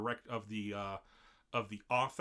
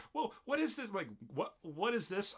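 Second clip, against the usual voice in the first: put-on panicked girls; 10 semitones higher than usual. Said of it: "they're just like".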